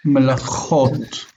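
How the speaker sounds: melachot slowly, with a throaty ch; guttural ch. Shown as a demonstration. Teacher repeats the word for the student, slow guttural ch in melachot.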